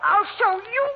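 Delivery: high furious shriek; defiant shriek. Acted. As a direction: scream it in rage. Killer screams defiance.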